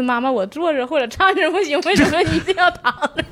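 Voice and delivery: high-pitched imitation of a cute child, laughing; cute little daughter. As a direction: lightly mimic a puzzled little girl. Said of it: "我闺女有时候也很萌". (学闺女萌萌的高音)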